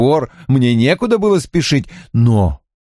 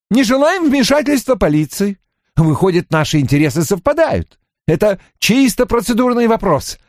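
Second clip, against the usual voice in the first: higher-pitched, pushy businessman pitch; insistent persuader; 8 semitones higher than usual. Acted. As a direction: talk him into it, insistently. Narrator plays O'Neill pressing his case.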